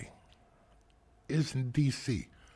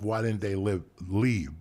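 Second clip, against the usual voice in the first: usual speaking voice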